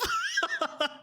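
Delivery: high-pitched giggling burst; laugh. Real, not acted. Streamer laughing at being smacked while driving, high giggle. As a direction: break up laughing. Cracking up at own story.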